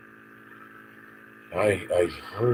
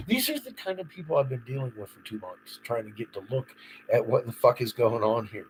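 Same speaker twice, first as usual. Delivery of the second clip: ordinary talk, nothing marked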